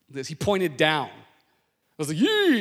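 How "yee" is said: sudden high, loud startled squeal; a yelp of shock at a cop's glare. Retold or imitated. Driver yelping when a cop points at him.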